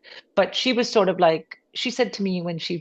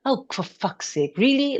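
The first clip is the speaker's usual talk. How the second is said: mock-incredulous sister, jumping high on "what" and "really"; retold sisterly disbelief, pitch leaping up; exasperated sister; 8 semitones higher, slower than usual. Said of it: "she was always like".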